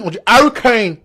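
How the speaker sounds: "Harry Kane" in exaggerated English; mock English accent. English fans correcting the name, mock English accent. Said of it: "Si les Anglais disent".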